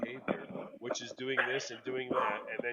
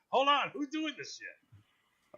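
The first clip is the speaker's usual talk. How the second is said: high mock alarm; 12 semitones higher, 5 dB louder, slower than usual.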